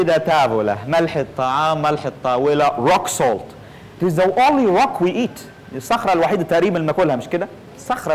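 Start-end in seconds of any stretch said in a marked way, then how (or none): none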